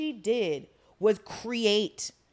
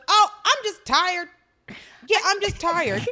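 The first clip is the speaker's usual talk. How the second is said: whiny mock tired; 7 semitones higher, 6 dB louder than usual. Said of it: "she goes".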